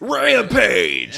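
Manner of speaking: high, loud shouted title. Real, not acted. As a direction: yell it out like a movie trailer. Shouting a Rock movie title.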